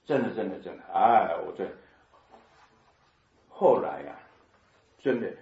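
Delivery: ordinary talk, nothing marked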